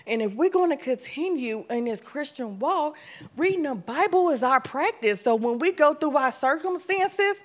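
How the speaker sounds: full-voiced preaching about the weapon. Real, not acted. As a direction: stir the congregation.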